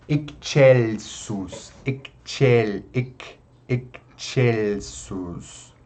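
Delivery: "excelsus" slowly as "ek-chel-sus". Shown as a demonstration. Teacher modelling Latin X, "excelsus" as "ek-chelsus". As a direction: break "excelsus" after the K, then CH. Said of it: "esse som de K S".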